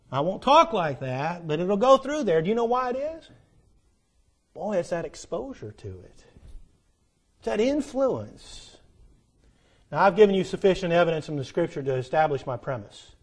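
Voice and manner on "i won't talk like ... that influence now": ordinary talk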